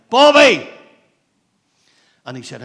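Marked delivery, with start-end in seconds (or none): 0.1-0.7 big high shouted name, as the voice in the night; retold shout. Bobby retelling the voice that woke him, loud shout. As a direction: call the name out loud and sharp. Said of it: "I heard a voice shout".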